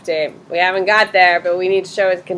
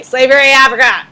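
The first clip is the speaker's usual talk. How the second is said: blurted, louder mock of a vague answer; mocking student answer; 5 semitones higher, 9 dB louder, faster than usual. Shown as a demonstration. Teacher mocking a student's vague answer, loud and blurted. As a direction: shout it like the lazy answer. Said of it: "You can't just say".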